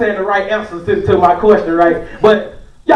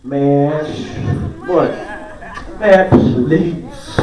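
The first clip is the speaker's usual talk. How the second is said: dismissive; 7 semitones lower, 4 dB quieter, much slower than usual. Retold or imitated. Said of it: "y'all like".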